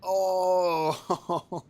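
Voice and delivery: loud, high amazed whoa; amazed shout. Real, not acted. Amazed by the duel scene.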